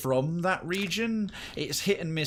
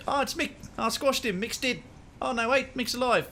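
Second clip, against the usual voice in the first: much higher, thick South African rock monster; South African accent; 10 semitones higher than usual. Retold or imitated. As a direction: play the cheerful rock monster, strong accent. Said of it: "It's like".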